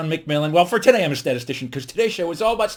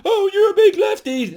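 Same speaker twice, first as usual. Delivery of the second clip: high, loud, drawn-out whine of accusation; hammed-up complaint, pitched way up; mock outraged emailer; 7 dB louder than usual. Angry viewer emailing the comedian about his politics, mock outrage. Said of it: "send me an email going".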